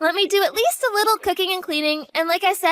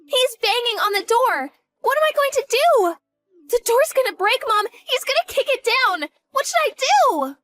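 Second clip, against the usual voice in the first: panicked; 6 semitones higher than usual. Acted. Father breaking down the door.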